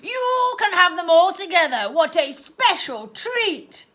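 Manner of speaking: dote on the pet; aunt treating her owl; cooing aunt. Acted.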